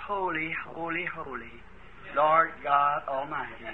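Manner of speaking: hushed, low and very soft; very light. Acted. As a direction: say it very lightly, barely voiced. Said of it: "saying it very lightly". (very lightly, sottovoce)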